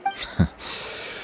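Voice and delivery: short breathy chuckle; brushing-off laugh. Acted. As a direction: laugh it off lightly. Chief laughs off the question.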